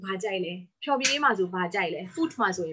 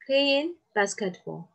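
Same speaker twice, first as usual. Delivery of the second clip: slow dictation; 5 semitones higher, much slower than usual. Shown as a demonstration. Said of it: "sports မှာဘာကြိုက်လဲ".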